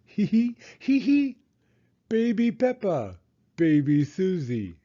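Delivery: put-on high babyish giggling voice; baby giggles. A narrator's reading. Narrator playing baby Peppa and Susie, high and babyish. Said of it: "Susie and Peppa giggled".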